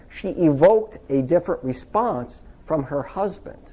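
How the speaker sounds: usual speaking voice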